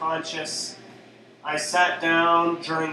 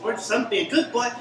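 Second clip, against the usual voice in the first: put-on higher, simple childlike voice, pleading with the policeman; childlike George; 7 semitones higher than usual. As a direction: play a simple, gentle grown boy begging for mercy. Slam poem in George's voice.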